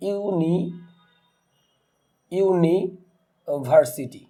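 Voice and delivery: University broken up very slowly with long pauses; syllable by syllable. Shown as a demonstration. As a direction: draw out uni, pause, then varsity. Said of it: "যদি আমরা বলি".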